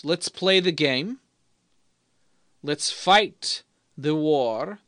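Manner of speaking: slow rallying call. Acted. Rallying toast.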